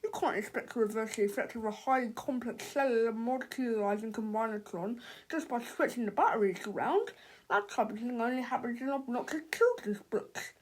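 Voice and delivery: lightly voiced smug scientist; condescending father. A narrator's reading. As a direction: talk down to the little boy. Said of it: "laughed Melvin's father".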